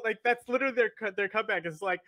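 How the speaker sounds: usual speaking voice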